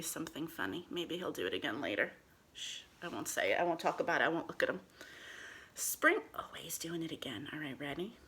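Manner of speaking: hushed. Real, not acted. Hushing so the cat isn't disturbed.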